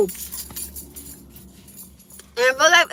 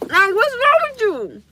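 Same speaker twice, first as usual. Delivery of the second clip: exasperated; 8 semitones higher, 6 dB louder, slower than usual. Real, not acted. Slow loud high exclamation.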